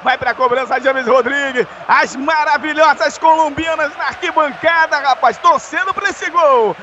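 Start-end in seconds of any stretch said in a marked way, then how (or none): none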